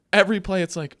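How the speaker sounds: normal talking voice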